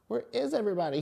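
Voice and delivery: high, puzzled little penguin. Acted. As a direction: wonder aloud, pitched high. Tacky wondering aloud.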